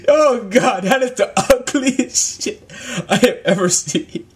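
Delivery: high, slow words broken by laughing; helpless laughter. Real, not acted. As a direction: talk through real giggles. Cracking up at the monster.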